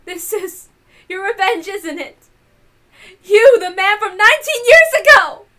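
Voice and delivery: rising into a high, loud accusing shout. Acted. Natsuhi shouts at the man from nineteen years ago. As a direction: build to a furious cry at the end.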